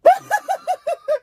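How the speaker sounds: very high-pitched mock cackle; imitated clown laugh. Reviewer acting out Art's reaction to the couple, high mock laugh. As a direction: burst into a squealing clown laugh. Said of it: "And then Art responds with".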